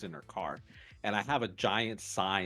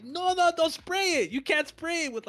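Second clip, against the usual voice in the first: high, loud panicked no-no; frantic shout; 6 dB louder than usual. Retold or imitated. Cashier yelling at a customer spraying sanitizer on the machine, alarmed. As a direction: stop them before they spray it.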